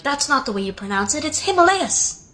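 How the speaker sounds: "Himalayas" as him-uh-LAY-uhz, pitched high; mock know-it-all stress on LAY in "Himalayas"; American correction. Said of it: "Americans have fought me on it".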